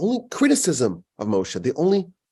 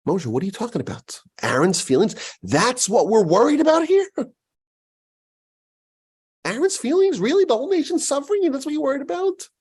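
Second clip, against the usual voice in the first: high, fast, incredulous scolding; rising disbelief, much higher and quicker; exasperated rebuke; 10 semitones higher, faster than usual. Retold or imitated. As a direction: demand to know how he can care about this. Acted rebuke of Moshe.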